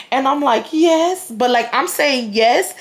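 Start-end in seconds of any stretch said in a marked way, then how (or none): 0.7-1.3 imitating scared answer. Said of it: "getting so scared".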